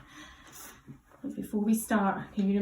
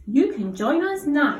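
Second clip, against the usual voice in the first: slow, louder and higher; expressive character line; 5 semitones higher, 9 dB louder than usual. Shown as a demonstration. Teacher demos the character's line for the child. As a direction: draw it out brightly like the character. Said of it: "I give it a go and see if you agree".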